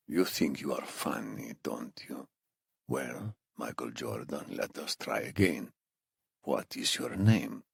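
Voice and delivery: put-on low, very soft accented threat; hushed captor losing patience; Iranian interrogator, quietly menacing. Narrator voices the accented captor.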